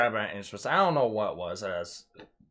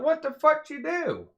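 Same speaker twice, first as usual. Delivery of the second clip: high, startled retold demand; incredulous retort. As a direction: relive the outburst, pitch leaping up. Retold turning round after being hit.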